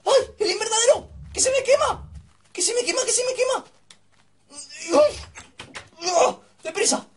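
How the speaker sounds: high-pitched cries of distress; panicked alarm. Real, not acted. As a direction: wail as your work goes up in flames. Greenhouse burning in game. (alarma aguda, con pánico)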